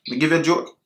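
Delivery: ordinary talk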